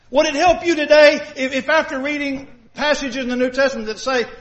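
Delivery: usual speaking voice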